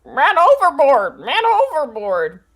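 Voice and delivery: high, loud squawking parrot cry. Acted. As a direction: shriek it like a panicked parrot. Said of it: "who acts as my voice".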